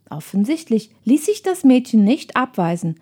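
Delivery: usual speaking voice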